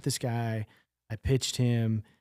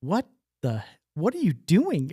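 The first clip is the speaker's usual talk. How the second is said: surprised outburst; 6 semitones higher, 5 dB louder than usual. Retold or imitated. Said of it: "And I was like".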